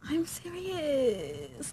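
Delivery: high, slow, drawn-out whine; whiny protest. Retold or imitated. Insisting to a boy not taking her serious, drawn-out whine. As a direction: stretch it out in a mock pleading whine. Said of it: "he's not taking me serious".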